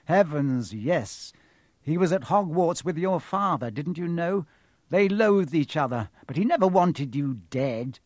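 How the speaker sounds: breezy, scornful explanation; casual. A narrator's reading. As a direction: toss it off as common knowledge. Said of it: "said Quirrell casually".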